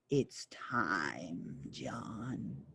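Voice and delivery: a looming devil's quiet doom; ominous big devil. Acted. The big devil comes for John, low ominous whisper.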